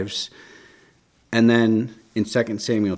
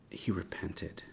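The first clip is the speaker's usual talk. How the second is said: whisper; 12 dB quieter, slower than usual. Real, not acted. Hushed close before the psalm.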